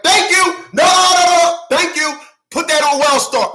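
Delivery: high, loud, dismissive cut-off; Kevin Samuels. Acted. Imitating a host shutting a caller down, loud dismissal. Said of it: "Kevin Samuels was like".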